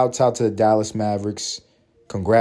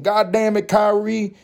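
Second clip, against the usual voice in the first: mock Texas fan; 8 semitones higher than usual. Voicing Texas fans mocking.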